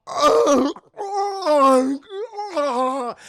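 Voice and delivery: loud, high agonized mock moans; drawn-out cries, dying away slightly; melting-man groans. Man melting in an acid puddle, hammed-up agonized wails. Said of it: "you just hear him go".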